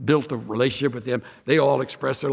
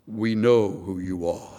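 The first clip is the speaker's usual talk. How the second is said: low imitation of a grand orator; eloquent chaplain; 6 semitones lower, slower than usual. Chaplain greeting the pastor, in mock-eloquent low voice. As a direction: imitate a dignified chaplain, pitched down. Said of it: "wonderful voice. I'm trying to act like it".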